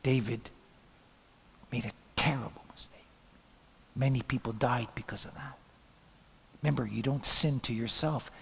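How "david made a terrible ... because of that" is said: hushed whisper. Real, not acted. Hushed turn after the temptation.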